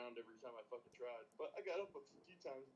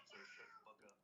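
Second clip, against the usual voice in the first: hushed whisper; 5 semitones lower, 9 dB quieter than usual. Real, not acted. Whispering the curse near kid.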